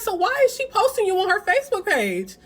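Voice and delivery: high, sharp accusing question; indignant demand. Retold or imitated. To her lying boyfriend on the phone, indignant demand.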